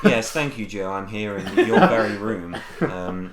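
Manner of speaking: hammed-up news presenter; newscaster voice. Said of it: "Get ready for my newscaster voice".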